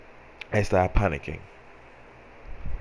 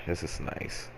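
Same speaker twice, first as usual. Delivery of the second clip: contented sigh; 10 dB quieter than usual. Retold or imitated. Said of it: "I was like".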